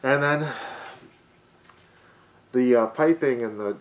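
muffled behind dust mask; speaking while adjusting mask; through a face mask. Real, not acted.

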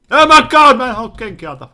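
very high, loud scream; real panicked scream. Real, not acted. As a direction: shriek it in panic. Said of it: "Sori, sori, että mä huusin".

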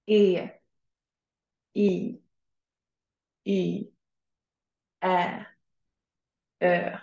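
long soft vowels "E, I, Y, Ä, Ö" modelled slowly and clearly; Swedish soft vowels. Shown as a demonstration. Teacher to class, modelling soft Swedish vowels to repeat. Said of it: "One more time".

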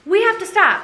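high, startled retold exclamation; retold alarm. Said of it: "I was like".

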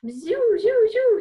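loud, high swooping zooms; flying zoom noises. Pretend. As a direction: zoom about like a flying puppet. Imitates puppet flying everywhere.

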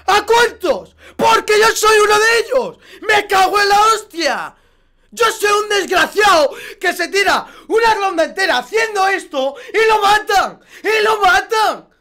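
furious, high-pitched ranting yell; real angry shouting. Real, not acted. Streamer raging to viewers about dying, real furious shouting. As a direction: shout your outrage at being killed. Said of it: "no me decís que por qué grito".

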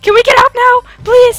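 high, loud plea to escape; panicked begging. Real, not acted. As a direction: beg frantically to get away.

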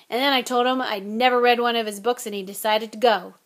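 fast, teasing gruff giant; a hint of a big gamekeeper; Hagrid voice. Hagrid's voice in the story.

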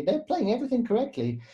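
usual speaking voice

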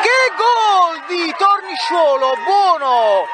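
high, slow, stretched che gol; excited goal call. Real, not acted. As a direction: savour the goal at full voice.

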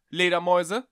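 loud, high questioning call into the dark; loud rising call, a little unsure. Acted. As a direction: call out into an empty attic. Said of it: "rief er".

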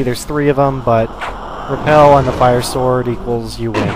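ordinary talk

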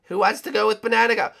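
usual speaking voice

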